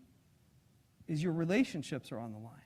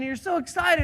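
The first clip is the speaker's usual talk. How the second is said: ordinary talk, nothing marked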